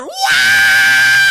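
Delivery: very high loud wail; mock anguished scream. Roommate screaming from the closet, a hammed-up wail. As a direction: scream out like a man in agony. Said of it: "The scream".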